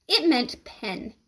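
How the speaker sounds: ordinary delivery, nothing marked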